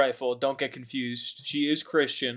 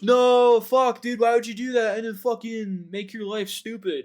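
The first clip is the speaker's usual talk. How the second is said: high, loud indignant wail; hammed-up monster outrage; outraged protest; 10 semitones higher, 5 dB louder than usual. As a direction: yell it like a betrayed creature. Monster protesting the destroyed bride.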